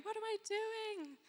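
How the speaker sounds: high, anxious questioning; nervous doubts voiced up high; panicked self-talk. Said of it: "looking up at the stars and going".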